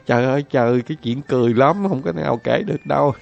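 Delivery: husband giggling through his words; laughing husband. Acted. Husband to wife, laughing too hard to explain. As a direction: try to answer while laughing. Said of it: "anh ta trả lời".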